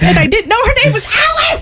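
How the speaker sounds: high, laughing wail of embarrassment; mortified exclamation. Retold or imitated. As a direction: build to a high cry on "Alice". Mock-mortified climax of her story.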